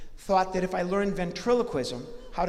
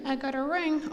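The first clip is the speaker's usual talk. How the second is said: a higher puppet voice, cheeky; Lefty the puppet; 7 semitones higher than usual. Acted. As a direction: pipe it up high and pleased with yourself. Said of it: "Are you married?".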